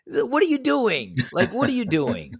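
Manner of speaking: put-on high, whiny childish squeal; a hint of a whining kid; childish mock whine. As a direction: mimic him like a squabbling child. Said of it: "It's just like children".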